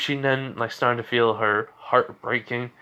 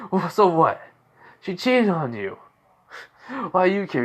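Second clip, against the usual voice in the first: higher-pitched, indignant comeback; defensive retort; 7 semitones higher than usual. Retold or imitated. Caught boyfriend to the man gripping his arm, defensive. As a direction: argue back, pitched up, mildly acted.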